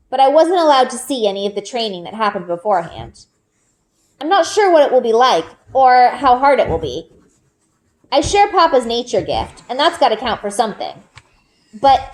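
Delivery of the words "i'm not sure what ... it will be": ordinary delivery, nothing marked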